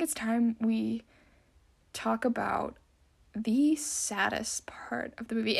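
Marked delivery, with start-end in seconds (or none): 3.3-5.6 dramatic whispered aside; hushed. Acted. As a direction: whisper it, slowly, like a secret. Dramatic hush before sad topic.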